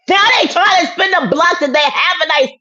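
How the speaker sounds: high, loud telling-off; angry shout. Real, not acted. Telling off the one who spins the block, loud.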